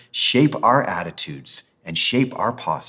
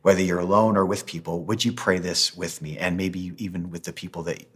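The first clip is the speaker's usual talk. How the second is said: ordinary talk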